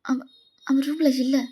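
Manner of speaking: worried and stumbling over words; panicked. Acted. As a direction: fret as you report him missing. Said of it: "எதுக்கு இப்ப பதட்டமா பேசுற".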